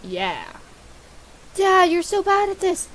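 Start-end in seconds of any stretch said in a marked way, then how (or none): 1.5-2.9 put-on higher-pitched kid griping at Dad; whining son voice. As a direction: play a son taunting his father. Acts son scolding dad in game.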